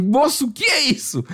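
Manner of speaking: retold protest, caught off guard; alarmed protest. Client protesting to the waxer, alarmed. Protests while the wax is applied.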